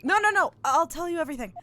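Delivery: high, loud burst of acted panic; panicked pleading. Captive threatened with the cold ocean, high panicked pleading. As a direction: blurt it out, pleading for your life.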